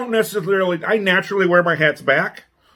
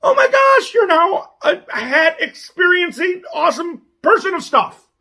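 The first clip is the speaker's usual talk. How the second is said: much higher, gushing praise; excited; 12 semitones higher than usual. Pretend. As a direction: gush with over-the-top delight. Mock congratulating viewers.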